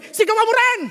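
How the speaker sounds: high, loud goading cry; acted shout back. Evil counsel urging a wife to shout back, loud goading. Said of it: "pag sinigawan ka ng asawa mo".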